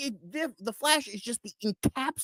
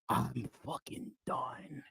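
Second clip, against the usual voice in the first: whispered, slow and spent; drained whisper to close the rant; exhausted whisper; 7 semitones lower, 15 dB quieter, slower than usual. Real, not acted. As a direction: breathe it out like you're finished. Ending rant, fading out.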